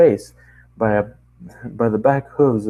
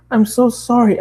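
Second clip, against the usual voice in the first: high apologetic pony; 14 semitones higher, 5 dB louder than usual. Acted.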